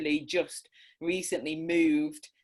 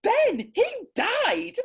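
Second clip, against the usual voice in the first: much higher and louder, each word slow and firm; indignant child; 6 dB louder, slower than usual. Retold or imitated. Boy insisting to the mum that Ben died, indignant. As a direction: correct the grown-up like an exasperated six-year-old. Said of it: "And he went".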